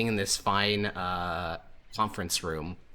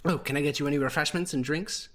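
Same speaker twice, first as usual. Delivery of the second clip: lightly voiced, higher, polite young serving woman; a hint of a bright helpful hostess; 6 semitones higher than usual. Servant greeting the party in the conference room, a lightly voiced polite young woman.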